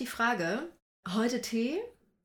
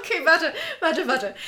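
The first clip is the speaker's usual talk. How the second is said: urgent; 10 semitones higher, 9 dB louder than usual. Real, not acted. Urgent interruption, high and loud.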